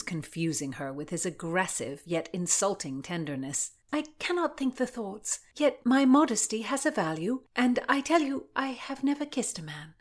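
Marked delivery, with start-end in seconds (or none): 3.9-9.9 high, earnest Constance; modest young gentlewoman. A narrator's reading. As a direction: protest your modesty in a raised voice. Voices Constance protesting her modesty.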